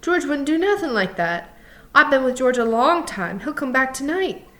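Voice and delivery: high-pitched, trusting insistence; insistent Lenny, protesting. A narrator's reading. Lenny defending George to Crooks, insistent. Lenny's dialogue in story narration.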